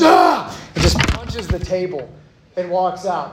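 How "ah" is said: short, high burst of a yell; sudden angry yell. Retold or imitated. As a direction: imitate the coach's out-of-nowhere outburst. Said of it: "he just goes".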